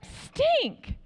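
high-pitched, squeaked-out frustration; comic dismay. Retold or imitated. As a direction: let out a mock-frustrated little exclamation. Retold reaction to minivan news.

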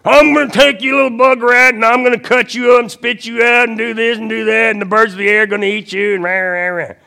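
high, very loud taunting yell; Goliath yelling. Acted. Giant taunts David before battle, yelling. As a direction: roar threats at a small opponent, trailing into mock growls. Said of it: "Goliath when he's yelling at David".

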